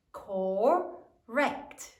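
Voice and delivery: "Correct" drawn out as cor, rect; syllable by syllable. Shown as a demonstration. Teacher finishes sounding out for class, "Correct" in two careful syllables. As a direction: blend "Correct" slowly with a break between syllables. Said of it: "Sound it out".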